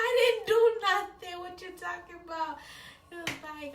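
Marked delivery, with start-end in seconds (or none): 0.1-2.6 higher, louder denial; protesting. Retold or imitated. Girl insisting to uncle she did nothing, higher protest. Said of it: "I was like".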